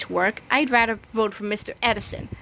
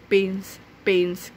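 usual speaking voice

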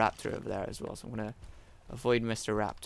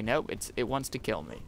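ordinary talk, nothing marked

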